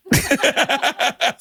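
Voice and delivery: sudden high burst of laughter; high cackling laugh. Real, not acted. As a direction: crack up at yourself. Laughing at his own story.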